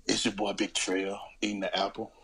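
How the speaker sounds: low, slow sensual intro; sexy talk. Pretend. Introducing himself to the podcast, sexy low voice. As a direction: purr the intro low and sultry.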